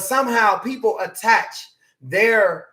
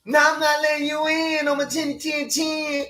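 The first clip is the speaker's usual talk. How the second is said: high piggy voice refusing the wolf; 7 semitones higher than usual. Retold or imitated. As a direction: play the little pig squeaking no. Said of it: "the big bad wolf".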